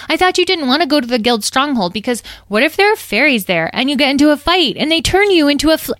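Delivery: high, rapid anxious rambling; fast, fretful tattletale voice; blurting worried fairy. Acted. Edwene blurts a worry.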